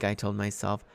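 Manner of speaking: ordinary delivery, nothing marked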